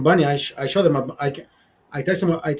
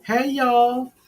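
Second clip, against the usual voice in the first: put-on high-pitched Southern girl greeting; 6 semitones higher than usual. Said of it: "Those are the Southern girls".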